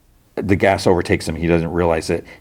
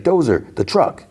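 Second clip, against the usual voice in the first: retold yell; 8 semitones higher than usual. Gang member calling to his friend amid the fight, retold yell. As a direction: call out urgently without real volume. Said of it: "he yells out".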